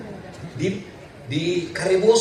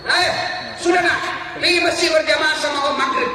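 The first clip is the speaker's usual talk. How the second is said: calling out; 10 semitones higher than usual. Acted. Uncle calling boy from field.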